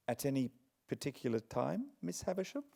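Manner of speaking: put-on soft, quick, timid boy's question. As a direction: ask shyly. Pip asks timidly.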